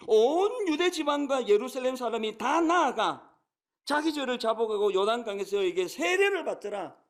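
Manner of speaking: scripture reading. Acted.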